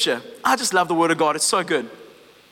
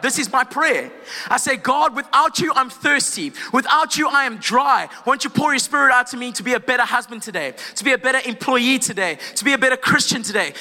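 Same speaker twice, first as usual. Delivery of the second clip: higher-pitched, urgent declaration in driving phrases; fervent preaching; 4 semitones higher than usual. Real, not acted. Preacher declaring his desperate prayer to God, fervent preaching. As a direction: cry out in passionate prayer, line upon line.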